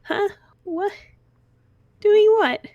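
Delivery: put-on a four-year-old caught off guard; lightly voiced startled little girl; Missy the little girl. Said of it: "It's like".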